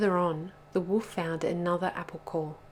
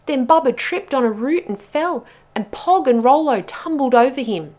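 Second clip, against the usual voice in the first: louder, higher, breathless-chase storytelling; urgent narration; 4 semitones higher, 10 dB louder than usual. A narrator's reading. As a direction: drive the chase along excitedly.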